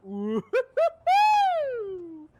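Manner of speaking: put-on loud, high drunken "ooh-hoo-hoo"; high hooting wail. Storyteller acting out being too drunk, high loud hooting. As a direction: hoot up high and let it ring out. Acts out his drunk reaction.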